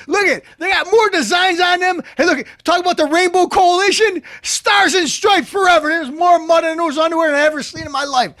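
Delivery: high-pitched comic squawk; worked-up, hammed-up high-voiced mockery; incredulous rant. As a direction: rant way up high, half shouting. Ranting mockery of her underwear.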